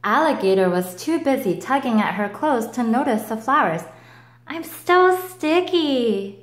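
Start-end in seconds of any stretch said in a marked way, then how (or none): 4.5-6.4 high, slow whiny complaint; whining. Acted. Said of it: "she cried".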